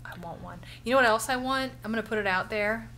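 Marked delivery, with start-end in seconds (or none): 0.0-0.6 whispered. Real, not acted. Wistful aside to family.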